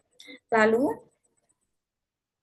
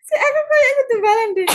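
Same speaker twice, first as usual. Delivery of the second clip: breaking character giggling; 12 semitones higher, 7 dB louder than usual. Real, not acted.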